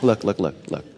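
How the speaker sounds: usual speaking voice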